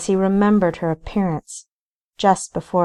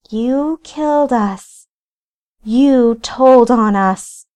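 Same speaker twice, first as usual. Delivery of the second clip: high, slow, loud ghostly accusation; accusing ghost; 5 semitones higher, 5 dB louder, slower than usual. A narrator's reading. Ghost Rachel accuses Paxton, slow, high and eerie. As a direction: draw the words out like a vengeful ghost.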